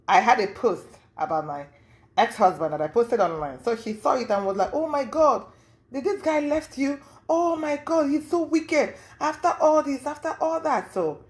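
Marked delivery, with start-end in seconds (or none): 4.7-10.9 high-pitched indignant rant, not shouted; mock-appalled outpouring; shocked ranting. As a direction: imitate her outraged commiseration, pitched high. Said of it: "you know, ranting".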